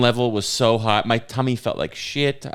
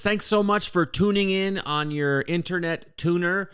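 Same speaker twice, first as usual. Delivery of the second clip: announcer voice; 8 semitones higher than usual. Acted. Podcast intro announcer voice.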